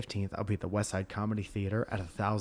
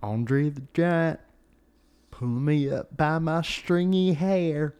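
slow, loud southern wrestler drawl; Macho Man with a southern drawl; 4 semitones higher, 4 dB louder, slower than usual. Retold or imitated. Imitating a wrestler complaining about rivals, in a loud slow southern drawl. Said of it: "the Macho Man had a southern drawl".

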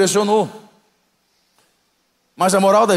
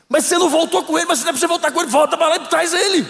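impatient pastor's wife; 11 semitones higher, faster than usual. Retold or imitated. Pastor's wife sends disciple again.

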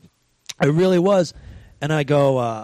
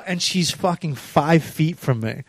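usual speaking voice

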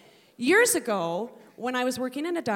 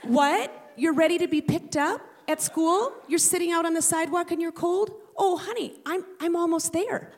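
loud, higher, mock flustered mom; fake phone call; 6 semitones higher, 4 dB louder than usual. Mother faking a rushed phone call, mock flustered. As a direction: pretend you're rushing over.

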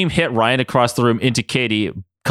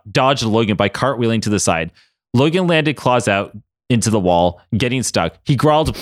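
usual speaking voice

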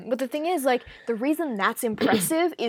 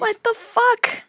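high, slow, drawn-out disbelief; stunned what the fuck; shocked disbelief; 5 dB louder, slower than usual. Real, not acted. Reacting to a creepy story.

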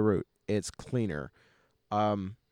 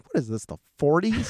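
higher, dramatic disbelief; mock incredulous; 6 semitones higher, 4 dB louder than usual. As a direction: ham up the outrage. Mocking teens smoking at a party.

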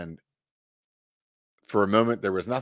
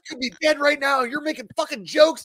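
much higher, louder hammed-up rant; mock angry cussing; 5 dB louder than usual. Buddy scolds him for joking after nearly dying, mock furious yelling. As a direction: imitate a furious buddy yelling, half laughing. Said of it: "starts just cussing me out".

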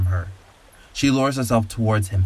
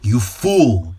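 contempt; 3 dB louder than usual. A narrator's reading. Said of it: "with contempt in her voice".

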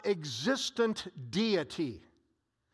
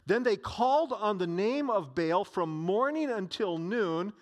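ordinary talk, nothing marked

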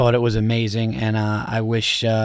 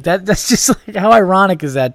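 laughing; 7 semitones higher, 5 dB louder than usual. Real, not acted. Laughing at the anaconda irony.

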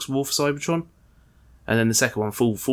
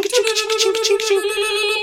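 high, slow chinking coin noise; coin sound effect; 4 dB louder, much slower than usual. Retold or imitated. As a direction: imitate casino coins falling. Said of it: "sound effects where there's cash money going".

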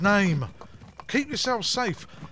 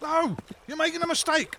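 high-pitched, desperate protest; panicked protest; 7 semitones higher than usual. Acted. Suspect protesting as he is arrested, panicked. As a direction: plead your innocence, voice pitched up.

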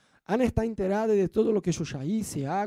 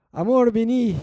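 louder, higher "Amor, vení" falling at the end; calling out; 4 semitones higher, 7 dB louder than usual. Retold or imitated. Wife calling her busy husband, loud call falling. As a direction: call him from across the house. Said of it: "A veces la mujer dice".

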